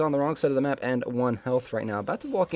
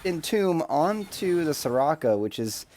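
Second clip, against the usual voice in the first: excited, raised caster voice; live play-by-play; 5 semitones higher than usual. Real, not acted. Caster calling a bot lane fight, excited play-by-play. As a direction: call the fight as it unfolds, building in energy.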